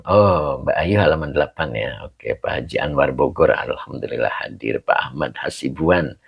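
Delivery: ordinary talk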